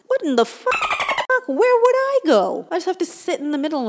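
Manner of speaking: sudden squeaky high panicked question; mock panic. Vlogger panicking in mock dismay about prison cliques, shrill high squeal. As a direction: shriek it in comic dismay at having no group.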